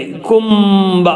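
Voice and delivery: "കുംബ" said slowly with a long held m; n read as m. Shown as a demonstration. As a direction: stretch the m in "കുംബ" before releasing ba. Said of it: "എന്ന വായിക്കണേ".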